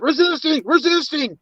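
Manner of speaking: high mocking cop voice. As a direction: sneer the word the way the cop said it. Cop accusing him during arrest.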